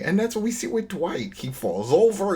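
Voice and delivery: ordinary delivery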